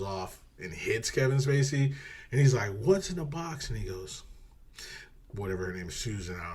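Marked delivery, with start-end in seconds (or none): 2.8-3.7 loud, high acted yell; desperate demand. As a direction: burst out demanding an answer. Acts Brad Pitt demanding after scuffle.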